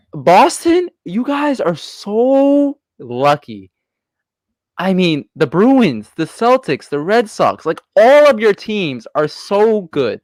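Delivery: high-pitched, drawn-out fan envy; real exasperated envy. Real, not acted. Host to Boston fan about lucky teams, exasperated envy. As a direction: stretch the words in envious disbelief.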